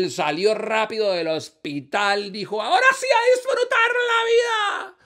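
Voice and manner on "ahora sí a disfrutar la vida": high, loud whoop of joy; jubilant cry. Acted. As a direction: exult in a shrieky high voice. Woman rejoices leaving the hospital.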